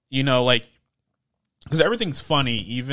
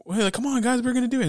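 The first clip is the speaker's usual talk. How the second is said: mock coaxing; 10 semitones higher, faster than usual. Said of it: "everyone's like".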